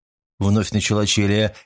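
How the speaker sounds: usual speaking voice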